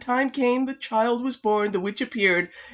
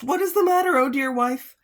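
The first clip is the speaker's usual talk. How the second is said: worried husband; 4 semitones higher, 4 dB louder than usual. Acted. Husband asks worried wife.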